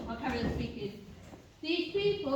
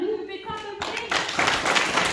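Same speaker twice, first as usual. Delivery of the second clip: calling out; 7 semitones higher, 9 dB louder than usual. Real, not acted. Calling the crowd to clap.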